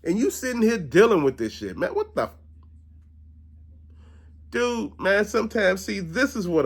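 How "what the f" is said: exasperated squeak. Real, not acted. Exasperated at cheating story.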